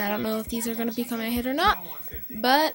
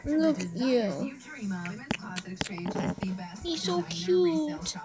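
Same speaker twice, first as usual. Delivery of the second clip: cooing to the cat; 6 semitones higher, slower than usual. Real, not acted. Cooing over the cat in her lap.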